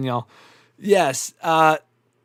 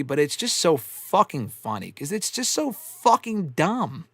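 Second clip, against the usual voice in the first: normal talking voice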